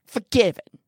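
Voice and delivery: put-on loud, outraged old man's repeat; sharp incredulous burst of contempt; spat out. A narrator's reading. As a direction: spit the word back explosively. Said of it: "he spat out".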